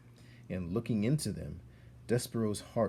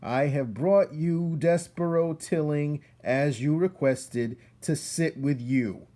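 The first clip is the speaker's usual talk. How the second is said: loud, higher announcement, slowing at the end; shouted; 7 semitones higher, 8 dB louder than usual. Acted. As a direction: proclaim it to the council. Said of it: "shouted Furlow".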